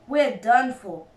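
ordinary delivery

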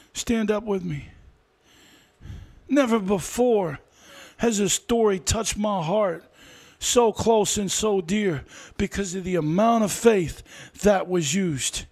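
hushed testimony. Real, not acted. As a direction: speak it close and dear. Softer, lower preaching.

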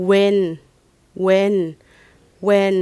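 "when" said "wen", loud and level; "when" sounded out as "wen" for pupils; model pronunciation. Shown as a demonstration. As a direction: model "when" with a plain w sound, pausing between repeats. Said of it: "จะออกเสียงเป็น".

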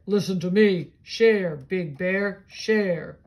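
put-on loud booming old oak tree; deep tree voice. Reading a picture book aloud, the oak tree booms at Bear. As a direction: boom it out as the giant oak. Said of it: "A deep, low voice said".

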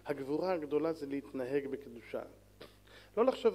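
ordinary talk

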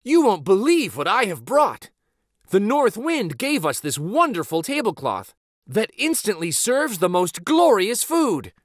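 high, loud, eager telling; bursting with news; excited boy. Acted. Boy showing his mother the tablecloth, high and thrilled. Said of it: "the boy cried excitedly".

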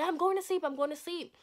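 high, insistent brush-off; retold whiny protest; flustered escape. Escaping dad's questions.

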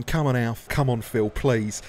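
ordinary talk

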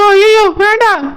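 high, pleading no; alarmed refusal. Acted. Girl begs Alamelu not to go in, alarmed. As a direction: cry out in sudden alarm.

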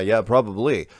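usual speaking voice

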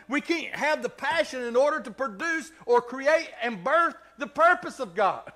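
loud, high-pitched, driving sermon; full-force preaching. Real, not acted.

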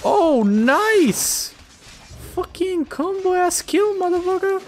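high-pitched triumphant squeal; excited cheer. Real, not acted. Player celebrating a combo kill, high excited cheer. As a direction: crow over the kill, voice leaping up high.

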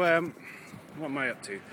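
normal talking voice

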